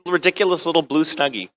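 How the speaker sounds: ordinary talk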